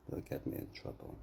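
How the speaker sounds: soft whispered aside. Real, not acted. A speaker whispering to viewers that a story would get him in trouble. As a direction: whisper it as if caught.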